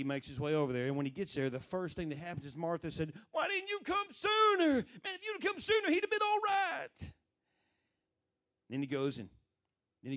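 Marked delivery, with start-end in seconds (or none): 3.3-7.1 acted reproach. Martha meets Jesus four days late, high wailing reproach. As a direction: cry it out high, as an aggrieved Martha.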